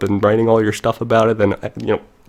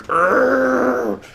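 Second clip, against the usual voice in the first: put-on higher strained old-man groan; overloaded computer groaning like an old man; straining groan; 14 semitones higher than usual. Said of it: "It's like an old person".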